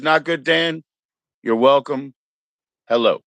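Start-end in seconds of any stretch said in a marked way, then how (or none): none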